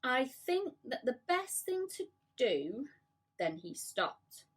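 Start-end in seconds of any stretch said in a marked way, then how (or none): 0.0-2.9 slow, high, thoughtful; slowly. A narrator's reading. James slowly offering his plan to the others. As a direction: stretch it out, thinking aloud. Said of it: "James said slowly".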